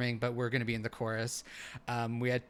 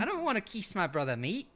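fast, higher mock Aussie whine; Australian accent; 6 semitones higher than usual. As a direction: rattle it off like a cranky Aussie sister. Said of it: "These are really good impressions".

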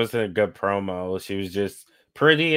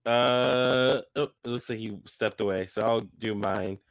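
ordinary talk, nothing marked

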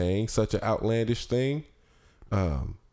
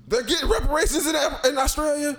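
high, delighted disbelief; surprised; faster than usual. Real, not acted. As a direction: squeal it in surprise.

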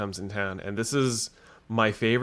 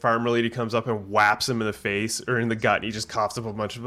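ordinary talk, nothing marked

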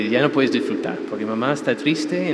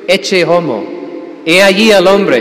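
slow, much louder and higher proclamation; Pilate proclaiming; 6 semitones higher, 9 dB louder, slower than usual. Retold or imitated. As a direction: announce it to the crowd like a governor. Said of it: "Cuando saca Pilato". (Pilato proclamando, lento y mucho más fuerte)

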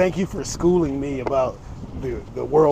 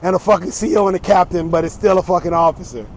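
louder, digging in on the point; stubborn loud retort; 4 semitones higher, 7 dB louder than usual. Real, not acted. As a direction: shout it back like you won't budge. Heated argument about navy ranks.

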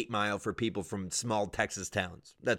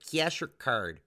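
higher, drawn-out Midwestern clerk; Metro Detroit accent; 5 semitones higher, much slower than usual. Retold or imitated. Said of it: "And they're like".

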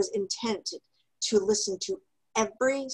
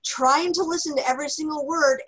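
ordinary delivery

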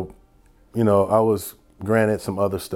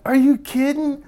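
very high-pitched incredulous retort; hammed-up astonishment at bad news; mock disbelief. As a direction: play up the disbelief, voice leaping way up. Retelling disbelief at five-hour drive.

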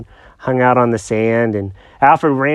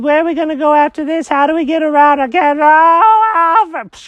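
high-pitched confused cat; squeaky cartoon cat, lost and puzzled; 13 semitones higher than usual. Jenkins voice on Mars.

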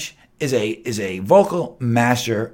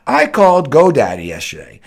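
ordinary talk, nothing marked

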